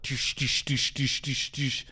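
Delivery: rapid, even "doosh" knocks, softened; imitated window knocking. Driver recounting a knock on the car window, imitated knocking. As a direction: mimic repeated banging on glass with the mouth. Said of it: "like, a knock on the window".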